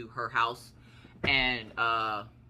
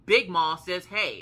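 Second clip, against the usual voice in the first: loud, high-pitched call-out; loudly imitated greeting; imitated child relaying a greeting; 4 semitones higher, 10 dB louder, much slower than usual. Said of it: "and she goes".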